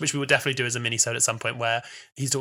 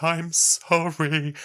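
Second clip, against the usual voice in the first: imitated sobbing; 3 semitones higher, much slower than usual. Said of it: "bawling and is just apologizing".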